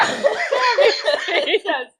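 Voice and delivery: very high, loud, laughing too hard to read; helpless laughter. Real, not acted. Woman trying to read a dating profile aloud, helpless laughter. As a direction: dissolve into big laughter on the words. Cracks up before reading next message.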